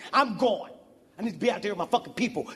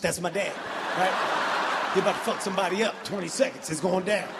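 ordinary talk

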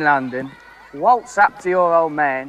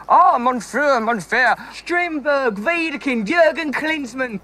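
mock German; 6 semitones higher, slower than usual. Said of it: "But can you do German?".

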